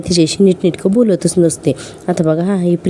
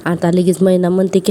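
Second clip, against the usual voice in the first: usual speaking voice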